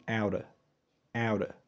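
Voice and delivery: "out of" as "OW-duh", no v; soft, clipped-off "out of" without its v; most reduced form. Shown as a demonstration. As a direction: flap the t and end "out of" on a schwa. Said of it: "عاوزين نسرعها أكتر".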